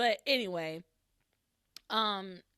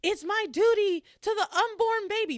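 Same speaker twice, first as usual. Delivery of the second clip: higher and louder, a half-acted shout; retold yell; 12 semitones higher, 8 dB louder than usual. Pilar yelling from the kitchen about her duty, loud retold shout. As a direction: belt it out like she's yelling in the kitchen. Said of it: "She's, like, yelling".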